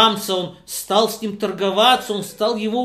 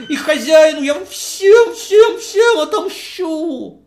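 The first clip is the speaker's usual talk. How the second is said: much higher, loud furious cry; the thrown-out guest swearing revenge, loud and much higher; vowing revenge; 9 semitones higher, 6 dB louder than usual. Acted. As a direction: scream out a vow of vengeance. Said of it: "И он сказал".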